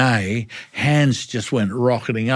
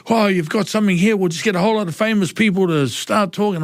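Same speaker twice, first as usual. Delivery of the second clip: mock board member; 10 semitones higher, faster than usual. Board voiced in retelling.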